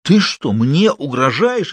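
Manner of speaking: sharp high astonished question, slowed; stunned by the threat. A narrator's reading. Boss amazed the surgeon talks back, astonished. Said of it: "изумился начмед".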